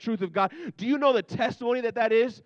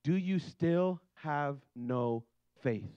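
slow solemn question; 5 semitones lower, 6 dB quieter, much slower than usual. Retold or imitated. Jesus' question reread slowly.

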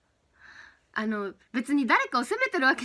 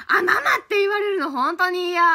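louder, higher, half-laughing protest; 6 semitones higher, 7 dB louder than usual. Real, not acted. To chat who call her mama, loud protest. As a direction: blurt out your objection.

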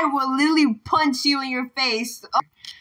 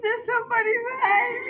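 high, fast panicked cry; terrified high yelp; 10 semitones higher, faster than usual. Real, not acted. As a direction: blurt it out in real panic. Panicked at ghost behind.

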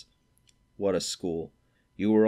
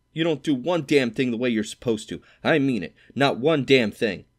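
higher, louder, slowing on the last words; furious scolding; 5 semitones higher, 6 dB louder than usual. A narrator's reading. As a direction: hammer each word home in fury. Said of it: "He was really furious".

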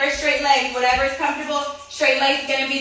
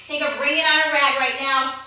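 usual speaking voice

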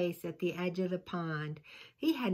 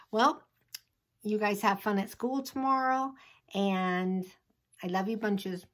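ordinary delivery, nothing marked

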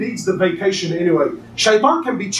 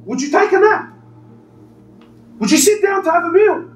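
fiery preaching; 9 semitones higher than usual. Acted. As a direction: preach to the crowd with full force. Preacher rising to full force again.

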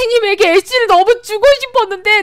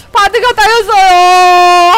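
바드가 따였어요 stretched very slowly and louder, stress on 바드가; mock wail; 4 semitones higher, 9 dB louder, much slower than usual. Streamer hamming up grief for the fallen Bard, slow louder wail.

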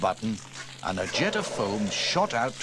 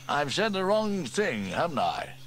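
Steele voiced higher, a little sheepish; sheepish fireman chief; 8 semitones higher than usual. Fire chief admits his blunder to the crew, sheepishly. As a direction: own up to a blunder, lightly voiced.

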